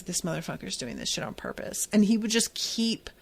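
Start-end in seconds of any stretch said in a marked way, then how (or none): 0.0-1.9 fast, soft whisper under the breath; muttered realization. Retold or imitated. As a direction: whisper it like a secret grudge. Kid's inner realization about dad.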